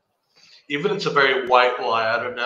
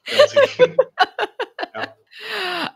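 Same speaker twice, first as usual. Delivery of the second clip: laughing; much slower than usual. Real, not acted. Laughing admission.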